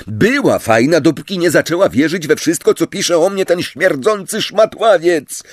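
high, heated tirade; indignant, worked-up outburst; angry roar. A narrator's reading. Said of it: "ryknął Harry".

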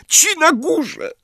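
high, slow, cracking wail; dramatic breaking cry. A narrator's reading. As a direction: wail it like a tragic heroine. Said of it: "dramatycznym, załamującym się głosem". (dramatyczny, łamiący się głos)

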